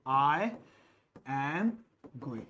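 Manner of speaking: slow, each word set apart by a pause. Shown as a demonstration. Teacher to students, dictates slowly word by word. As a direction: spell out "I", "am", "going" one at a time with gaps. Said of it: "I am going (said normally first)".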